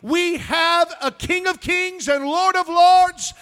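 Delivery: high, loud pulpit shout building in force; full-force preaching. Real, not acted. Preacher rousing a congregation to thankfulness, full-force preaching. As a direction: proclaim it at the top of your voice, stretching the key words.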